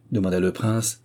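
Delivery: ordinary delivery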